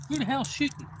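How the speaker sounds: high, startled yell; startled shout. Retold or imitated. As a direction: jump at the sudden noise, voice shooting up. Startled by the line snapping.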